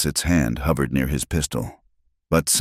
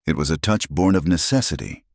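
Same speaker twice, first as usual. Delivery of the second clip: plain reading voice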